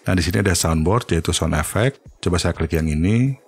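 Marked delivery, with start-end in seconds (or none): none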